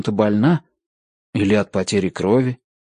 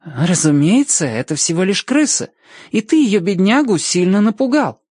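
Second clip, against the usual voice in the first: put-on high-pitched indignant girl; offended reproach, voice raised in pitch; 8 semitones higher than usual. A narrator's reading. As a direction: scold him for scaring a poor creature. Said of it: "Она возмущённо посмотрела на него".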